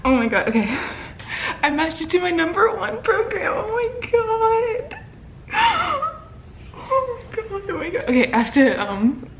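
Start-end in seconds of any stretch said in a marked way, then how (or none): 1.6-8.0 high, tearful voice cracking between words; overwhelmed, half laughing, half sobbing; crying with joy. Real, not acted. Telling viewers she matched her top program, in happy tears.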